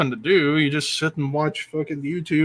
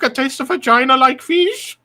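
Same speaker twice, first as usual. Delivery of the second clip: mock Chinese accent; 9 semitones higher, 4 dB louder than usual. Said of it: "the Chinese food bit".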